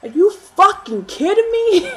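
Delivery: high, loud, slow incredulous outrage with a laugh; furious disbelief. Retold or imitated. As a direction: yell it in stunned outrage. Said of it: "I was just like".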